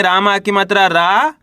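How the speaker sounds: slow, रा held with a rising tune; spelling aloud. Shown as a demonstration. Teacher spelling a word for learners, slow with stretched रा. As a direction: spell it out and lift the final रा. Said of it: "तो कैसे पढ़ेंगे?".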